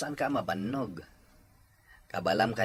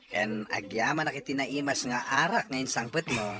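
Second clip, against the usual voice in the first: usual speaking voice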